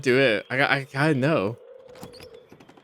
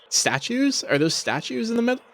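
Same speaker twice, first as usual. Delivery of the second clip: surprised; 10 semitones higher than usual. Real, not acted. Spotting odd statues in game.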